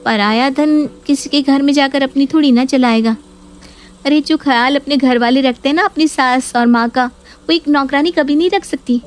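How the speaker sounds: a hint of a spiteful daughter; Neha's scornful voice. Neha voiced in a story.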